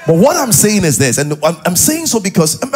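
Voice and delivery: ordinary delivery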